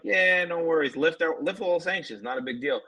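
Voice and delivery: breezy shrug of a reply; mock Biden. Podcaster mocking Biden's casual all-clear. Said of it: "Biden can be like".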